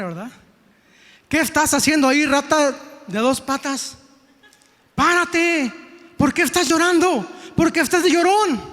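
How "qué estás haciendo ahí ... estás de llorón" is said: higher, sharp mock scolding; scolding wife. Wife Jezebel scolding crying King Ahab, mock anger. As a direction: chew out the lazy husband. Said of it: "Esa fue la actitud de Jezabel".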